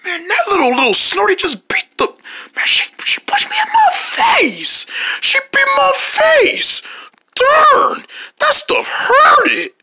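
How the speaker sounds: high strained voice of pain; pained whine. Acted. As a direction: whimper through the hurt face. Hurt after being punched.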